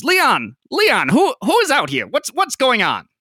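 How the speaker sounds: high, loud, huffy demand; the red-faced dwarf calling out from behind. Acted. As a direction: bark for Leon in a fluster. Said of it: "He has certainly been yelling".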